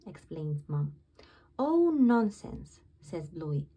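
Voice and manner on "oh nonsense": louder, slow brush-off; dismissive. A narrator's reading. Bluey waving off Mom's worry, dismissive. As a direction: wave the idea away.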